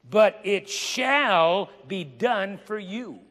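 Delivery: preaching proclamation. Acted. Preacher to congregation, stretched promise proclaimed. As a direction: ring out the promise.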